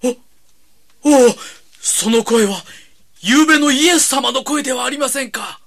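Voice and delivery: loud, high astonished joy; overwhelmed to hear that voice; amazed. Acted. Recognizes Jesus' voice.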